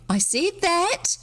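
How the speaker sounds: put-on high, slow indignant girl; a little girl insisting, high and slow; piping girl. A narrator's reading. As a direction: pipe it up, offended. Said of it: "she said".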